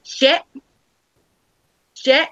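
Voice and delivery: high imitation of a little kid pointing out a ship; short high toddler exclamations; excited toddler. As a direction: mimic a small child calling out. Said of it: "And Lily goes".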